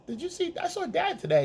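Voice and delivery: put-on very high, childlike voice; squeaky little-kid report; child voice. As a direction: chirp it like a small kid telling news.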